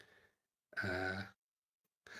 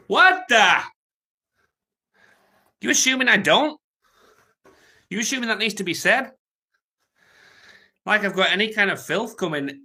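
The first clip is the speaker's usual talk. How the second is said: loud, high, offended yelp; shocked and insulted, blurt it out; indignant outburst; 10 semitones higher, 8 dB louder than usual. Real, not acted. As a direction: caught off guard by a rude comment, loud disbelief. Reacting indignantly to a chat message.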